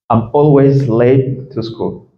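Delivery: slow, spaced-out English repeat; slow model sentence. Shown as a demonstration. Teacher repeats a student's answer to the class, slowly. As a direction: say each chunk slowly for learners.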